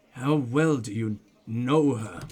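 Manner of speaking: slow, low and measured question; probing, low and unhurried; deliberate questioning. Acted. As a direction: draw it out in a low voice. Voices detective questioning suspect.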